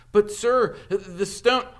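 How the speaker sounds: put-on high, loud, breathless boy's voice; panicked Harry. Harry wakes and urgently warns Dumbledore, high and panicked. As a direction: blurt it out in a rush of alarm.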